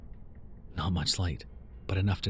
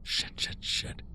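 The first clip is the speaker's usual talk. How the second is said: soft breathy swearing; under his breath; muttering; 6 dB quieter, much slower than usual. Acted. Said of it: "Andrew muttered".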